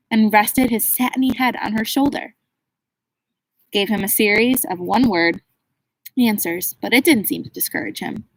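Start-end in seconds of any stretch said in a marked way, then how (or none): none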